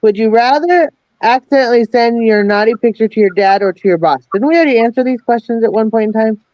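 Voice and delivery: usual speaking voice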